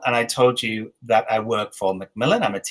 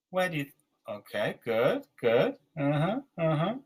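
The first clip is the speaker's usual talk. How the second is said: ordinary talk, nothing marked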